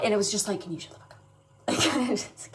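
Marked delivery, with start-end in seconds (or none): 0.6-1.2 hushed whispered jab; whispered retort. Retold or imitated. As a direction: mutter it under your breath. Mock retort to loud machine.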